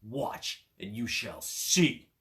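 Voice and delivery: slow, boastful ogre voice. A narrator's reading. Ogre showing off to the cat, slow and boastful. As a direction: puff up and boast like a giant.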